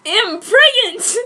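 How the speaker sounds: panicked announcement. Acted. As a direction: blurt out the shock.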